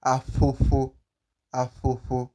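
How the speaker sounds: afofo with air puffed through both lips; afofo, each f a loose blown ph; correct ph pronunciation. Shown as a demonstration. Teacher showing learners how, correct ph pronunciation. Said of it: "that is how you achieve".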